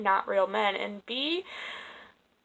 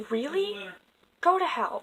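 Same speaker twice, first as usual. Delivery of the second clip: high, incredulous really, then a curt go to hell; telling off the man who mocked her dating choices, high and incredulous; indignant retort; 9 semitones higher than usual. Angry reply to insulting man.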